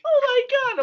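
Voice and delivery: very high, loud mock panic; freaking out. Retelling being brought towards Cam's office, panicked. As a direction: squeal it like you're panicking. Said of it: "I'm, like, freaking out".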